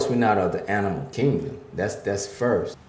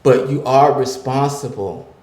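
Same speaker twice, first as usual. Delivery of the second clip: rising, louder preacher's cadence; preaching; 5 semitones higher, 8 dB louder than usual. Acted. Pastor preaching to the men listening, building and louder. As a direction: drive the point home like a sermon.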